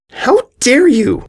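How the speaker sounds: high, slow, outraged; indignant yell. A narrator's reading. Diamond turns on Sweetie for batting her hoof, outraged. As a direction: draw it out in offended fury. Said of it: "Diamond yelled".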